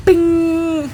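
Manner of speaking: high, held "bing"; ding like a little bell; high chime sound. Acted. Imitated chime in a quote.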